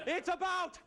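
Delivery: drawn-out battle cry on freedom; slow, high heroic shout; rallying cry. Acted. Scottish warrior proclaims what the fight is about, rallying shout.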